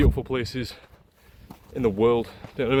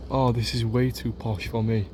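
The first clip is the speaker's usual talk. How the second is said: ordinary talk, nothing marked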